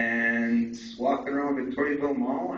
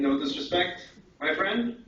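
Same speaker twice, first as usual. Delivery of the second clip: ordinary talk, nothing marked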